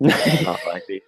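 slow, laughing line; mock dramatic laughing; laughing quote.

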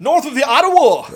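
high, loud cartoon Canadian voice; a hammed-up Canadian from up north; mock Canadian accent. Telling Justin where he lives, in a mock Canadian accent.

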